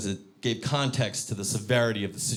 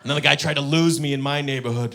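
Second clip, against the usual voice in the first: ordinary delivery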